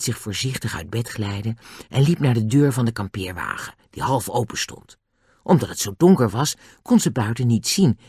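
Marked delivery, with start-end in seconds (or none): none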